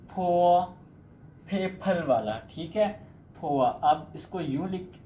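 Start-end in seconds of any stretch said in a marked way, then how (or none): 0.1-0.6 फो said louder with a strong puff of air, aspirated Chinese p; Chinese initial demo. Shown as a demonstration. Teacher presents the next Chinese initial, loud aspirated फो. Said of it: "next आपका है". 3.4-3.7 फोआ with an aspirated फ gliding into आ; Chinese syllable demo. Shown as a demonstration. Teacher repeats the aspirated syllable for learners, clear फोआ. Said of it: "इसका ये sound है".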